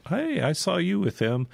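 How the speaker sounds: put-on accusing servant girl. Servant girl spots Peter following Jesus, mock accusing.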